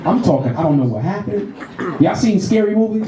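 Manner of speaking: ordinary delivery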